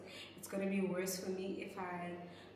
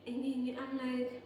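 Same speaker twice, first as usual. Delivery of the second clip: ordinary delivery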